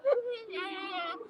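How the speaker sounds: high, drawn-out fake wail; mock wail. Vlogger mocking panicking critics, high fake wail. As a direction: let out a thin, hammed-up cry.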